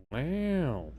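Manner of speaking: soft drawn-out "Wow" in Owen Wilson style; Owen Wilson impression. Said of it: "play that clip of him saying".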